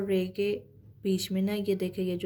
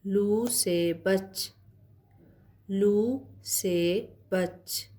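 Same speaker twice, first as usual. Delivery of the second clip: very slow, deliberate phrase with a pause before the last word; slow dictation reading; much slower than usual. Acted. Teacher reading a short warning phrase aloud, very slow. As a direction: draw it out word by word for beginners. Reads practice sentence very slowly.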